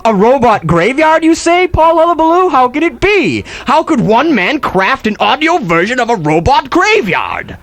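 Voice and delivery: high, hammed-up trailer voice; hammed-up promo voice hyping a robot graveyard; mock announcer. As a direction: tease it like a radio promo. Mock announcer teasing show.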